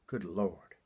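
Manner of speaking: hushed whisper of Good Lord; whispered aside. Acted. Comic whispering an aside to the crowd. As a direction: breathe it out in awe.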